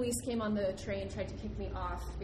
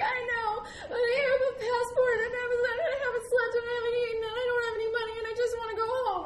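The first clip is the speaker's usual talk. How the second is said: high, loud mock-crying meltdown; hammed-up wailing; 9 dB louder than usual. Late traveler breaking down to airline lady, hammed-up wail. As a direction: wail it all out in one hysterical, sing-song run. Acts out tearful breakdown at gate.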